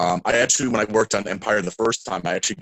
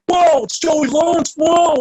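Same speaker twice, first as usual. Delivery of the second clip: high, loud, drawn-out fanboy whoa; excited shout; 8 dB louder, slower than usual. Retold or imitated. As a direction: gush like a starstruck kid spotting a star. Excited fan calling out.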